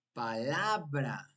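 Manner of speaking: "Palabra" slowly, stretching LA: pa-LAAA-bra; stress on middle syllable. Shown as a demonstration. Teacher showing the tonic syllable, "Palabra" stretched on LA. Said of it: "la sílaba tónica es la penúltima sílaba".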